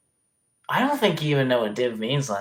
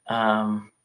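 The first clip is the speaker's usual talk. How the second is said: normal talking voice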